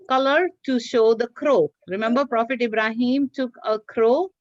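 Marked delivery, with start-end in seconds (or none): none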